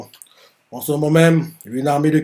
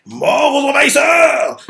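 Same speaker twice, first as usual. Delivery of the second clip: high, slow, loud war cry; battle cry; 10 semitones higher, 5 dB louder, slower than usual. Retold or imitated. Commander rallying his troops, shouting. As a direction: roar it to the whole army. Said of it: "Rimoku leur, leur dit".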